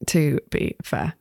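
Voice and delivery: slow, low, spaced-out words; deliberate echo. Pretend. Game master echoing a player's phrase, slowly and deliberately. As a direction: draw out each word deliberately.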